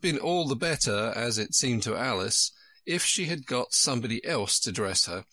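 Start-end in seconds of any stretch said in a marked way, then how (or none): none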